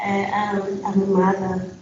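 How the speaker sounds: normal talking voice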